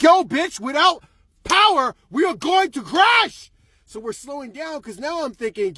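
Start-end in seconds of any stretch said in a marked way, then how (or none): 0.0-3.5 mock-furious yelling. Driver to traction control in his head, mock-furious yelling. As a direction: yell it at the car, spelling it out.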